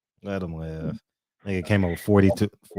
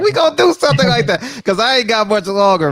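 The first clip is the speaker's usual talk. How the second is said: 1 excited; 12 semitones higher, 4 dB louder than usual. Real, not acted. Excited reply about a fight card.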